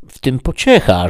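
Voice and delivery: a hint of a pleading peasant asking a favour; Sancho the squire. A narrator's reading. Sancho asking the housekeeper to stable his donkey, lightly pleading.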